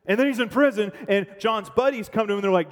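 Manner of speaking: normal talking voice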